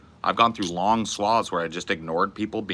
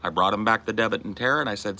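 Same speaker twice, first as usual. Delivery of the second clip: ordinary delivery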